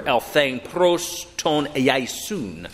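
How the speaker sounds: elthein pros ton iaisun read slowly in Greek, each word spelled out. Shown as a demonstration. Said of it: "that's the word I want you to remember".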